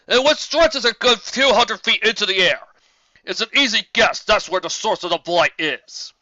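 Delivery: loud, high, far-carrying voice; a brash griffin lord booming across the war council. A narrator's reading. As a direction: boom it out like a proud commander. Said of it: "Gideon shook his head".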